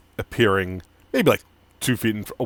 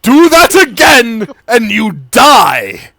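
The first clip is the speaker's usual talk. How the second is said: much higher, loud slow shouted threat; furious threat; 13 semitones higher, 11 dB louder, slower than usual. Acted. Tiefling shouting a death threat at Amari. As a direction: roar the threat.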